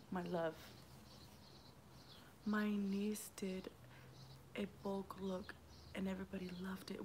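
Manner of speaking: soft unvoiced whisper, words barely breathed. Real, not acted. Vlogger whispering to her live viewers. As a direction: whisper it as if only to the phone. Reading a comment softly.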